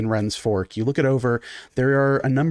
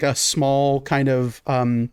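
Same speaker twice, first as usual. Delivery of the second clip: normal talking voice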